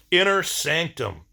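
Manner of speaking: ordinary talk, nothing marked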